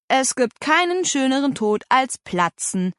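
joking. A narrator's reading. Brother teasing his hungry sister, joking. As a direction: toss it off as a tease. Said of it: "ulkte er".

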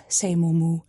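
usual speaking voice

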